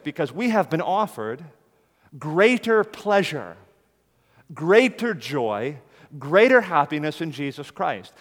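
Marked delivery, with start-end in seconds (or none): 2.2-8.1 drawn-out preaching climax; stretched emphasis. Real, not acted. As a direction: lean hard on each greater. Preacher's climax on greater joy.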